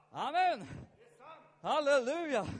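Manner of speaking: shouted praise, pitch up high; loud amen. Real, not acted. Preacher shouting praise to the congregation. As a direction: belt out the amen and halleluja.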